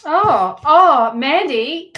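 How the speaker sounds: a loud, drawn-out oh of delight at the cards; delighted surprise at good cards; surprised. Real, not acted. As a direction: gasp with pleasure at the spread.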